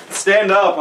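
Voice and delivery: ordinary talk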